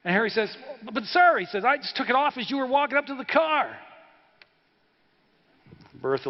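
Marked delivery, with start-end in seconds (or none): 0.8-1.4 high, louder stammered plea; acted husband pleading his case in a raised pitch; flustered protest. Husband protesting to the police officer. 1.7-3.8 high-pitched hammed-up excuse; flustered protest. Husband protesting to the police officer.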